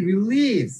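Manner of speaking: light রি, then lean hard on লিজ in release; second-syllable stress. Shown as a demonstration. Teacher giving a verb example, second syllable of release stressed. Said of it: "second সিলেবলটায় pressure যাবে".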